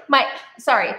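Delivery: ordinary talk, nothing marked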